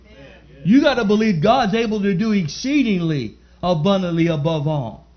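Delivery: loud, high preaching climax; preaching at full force. Acted. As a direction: drive the message home to the congregation.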